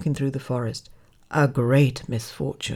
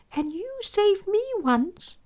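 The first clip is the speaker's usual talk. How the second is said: high, gentle squeaky voice; reply in a small piping mouse voice; grateful mouse. A narrator's reading. Mouse replies to the lion.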